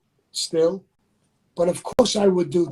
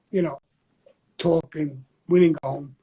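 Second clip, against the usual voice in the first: ordinary talk, nothing marked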